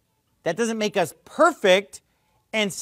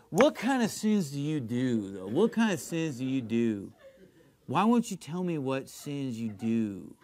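low, soft impression of a radio host; mocking imitation of a talk host, low and subdued; Jesse Lee Peterson; 5 semitones lower, 5 dB quieter than usual. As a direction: mimic the probing interviewer, voice dropped. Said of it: "this is how he talks".

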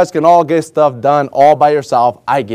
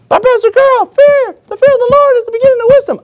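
much higher put-on voice of a know-it-all church member; mock congregant objection. Church member correcting Pastor Carl, mock high voice. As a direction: pipe up high like a smug listener correcting the pastor.

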